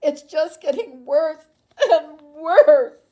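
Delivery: high, loud, tearful wail; crying despair. A narrator's reading. Mother despairs over her changing daughter, sobbing loudly. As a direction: break down sobbing on worse.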